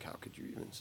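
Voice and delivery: near-whispered retold question; hushed disbelief. Recalling people talking at a show, hushed disbelief. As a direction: drop to a whisper, as if during a quiet set.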